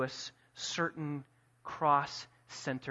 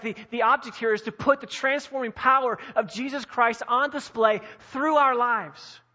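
loud, driving sermon delivery; full-force preaching; 5 semitones higher, 5 dB louder than usual. Acted. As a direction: build the appeal to the church.

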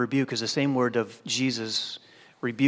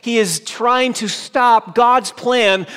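fervent preaching; 10 semitones higher, 10 dB louder than usual. Acted. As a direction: stretch each word out with force. Preaching at full force.

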